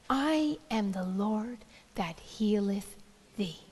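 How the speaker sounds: reverent whisper of the verse; whispered scripture. Acted. Preacher quoting God's word to the sick, whispering. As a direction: breathe the words as holy. Scripture voiced as God, hushed.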